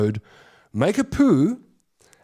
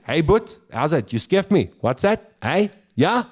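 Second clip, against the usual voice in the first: brash Joburg bro voice, higher; Joburg guy; 4 semitones higher than usual. Retold or imitated. Comic mocking Joburgers' brash greeting. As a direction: bark it like a Joburg oke. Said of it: "When you're in Joburg, it's".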